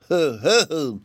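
high hammed-up ho ho ho; pompous chuckle. A narrator's reading. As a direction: chuckle grandly at the seal. Rabbit impressed by seal.

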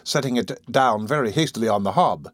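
ordinary delivery

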